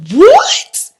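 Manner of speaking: loud, very high shriek of disbelief; shocked. Retold or imitated. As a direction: let one stunned word fly up high. Reaction to being detained.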